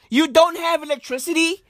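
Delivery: ordinary delivery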